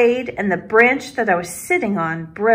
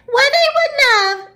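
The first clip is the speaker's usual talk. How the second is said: very high mocking squeal; high-pitched imitation; 4 dB louder than usual. A narrator's reading. Peter giggling as he imitates his friend, high-pitched. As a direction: mimic a girl in a squeaky high voice. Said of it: "imitating me in a high-pitched voice".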